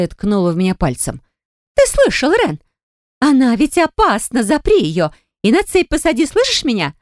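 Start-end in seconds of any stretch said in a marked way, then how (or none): 1.7-6.9 very high, agitated outburst; hysterical demand. A narrator's reading. Hysterical sister demands she be chained. (истерично, на высоких тонах)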